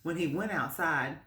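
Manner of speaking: usual speaking voice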